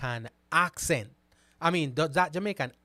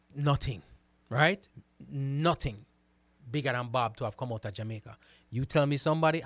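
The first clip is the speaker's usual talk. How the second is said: ordinary delivery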